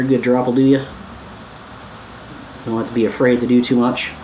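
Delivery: normal talking voice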